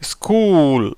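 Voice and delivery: ஸ்கூல் said as skool, s and k together, long oo; model pronunciation. Shown as a demonstration. Teacher shows students how to say ஸ்கூல், slow and clear. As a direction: say ஸ்கூல் carefully with a long oo vowel. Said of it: "எப்படி உச்சரிக்கப்படும்?".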